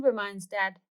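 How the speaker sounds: usual speaking voice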